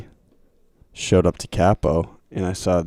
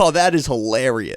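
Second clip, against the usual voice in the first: mock gushing; 6 semitones higher than usual. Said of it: "You're not like".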